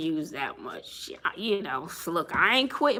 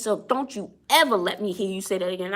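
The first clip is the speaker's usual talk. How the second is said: ordinary talk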